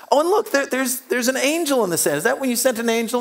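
high, hopeful mock excitement; mock eager. Preacher trying to impress Jesus with the sand, mock eager. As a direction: sell the excuse like an eager kid.

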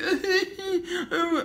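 very high fake crying wail, half laugh; mock sobbing wail. Mocking a rival YouTuber's tears with a fake high wail. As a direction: wail like a crybaby to mock him. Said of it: "You act like a little girl when you cry".

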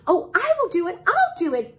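put-on high, loud, eager child character. A narrator's reading. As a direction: burst out volunteering. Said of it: "Phyllis said eagerly".

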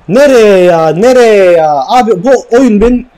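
loud, frustrated protest; exasperated outburst. Real, not acted. Gamer complaining that the game is maddening, exasperated. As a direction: wail at the stray pass. Pass goes wrong in game.

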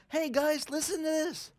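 high, bright-eyed announcement to everyone; preacher excitedly announcing the Messiah to a crowd, high pitched; eager proclaiming. Retold or imitated. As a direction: call out the news like a thrilled herald. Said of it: "starts proclaiming to everyone".

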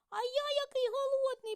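very high, loud squeaky mouse voice; hungry mouse squeaking for food. A narrator's reading. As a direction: squeal with hunger like a tiny mouse. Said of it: "пищить верть".

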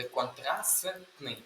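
«контрастний» с отчётливо выговоренной «т»; произнеси «контрастний» раздельно, выделив «т» между «с» и «н»; чётко по буквам. Shown as a demonstration. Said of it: "зберігається".

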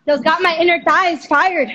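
loud repeat across a bad connection; raising voice to repeat. Real, not acted. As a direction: say it up loud for someone who missed it. Repeats louder when asked again.

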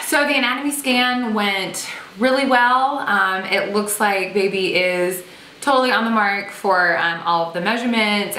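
ordinary talk

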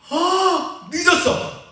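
high, startled cry of waking late; hammed-up oh-no-I'm-late; mock panic. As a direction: play the shock of seeing the clock. Acting out oversleeping panic.

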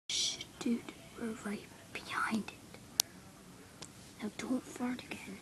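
hushed whisper; whisper, close to the horse. Acted. To his friend right behind the horse, a whisper.

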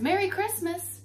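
high, loud joyful shout; shouting together. A narrator's reading. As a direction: cheer it out all together. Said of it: "they all shouted together".

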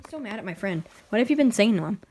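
ordinary talk

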